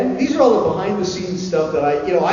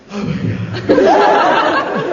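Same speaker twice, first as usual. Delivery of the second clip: laughing gasp; 12 semitones higher, 5 dB louder, much slower than usual. Real, not acted. Spider touches his neck.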